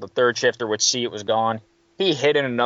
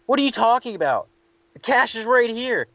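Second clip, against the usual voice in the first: higher, bewildered; mock baffled clerk; 10 semitones higher than usual. Third shifter baffled at the full drawer, higher and bewildered. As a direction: sound totally lost, higher.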